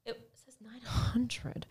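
hushed whisper of disbelief. Real, not acted. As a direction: breathe it out in a whisper. Reads price in shock.

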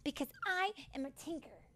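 proud little fairy. A narrator's reading. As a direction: declare who you are with pride. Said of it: "Tink replied proudly".

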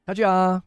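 Kaja lifted high with a held last syllable; going up. Shown as a demonstration. Streamer showing how Koreans say let's go, upward sing-song Kaja. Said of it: "they'll go up".

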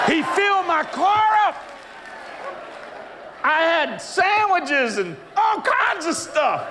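high shout, slow on the second line; excited shout. Real, not acted. Preacher shouting to the congregation, excited and high. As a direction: crow it out to the crowd.

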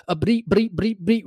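high, repeated stammered syllable; mock stuttering. Mock complainer triggered by the cartoon pig, stuttering. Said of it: "I have a stuttering problem".

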